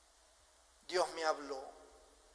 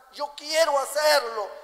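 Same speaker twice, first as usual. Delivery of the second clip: insisting David; 6 semitones higher, 7 dB louder than usual. Retold or imitated.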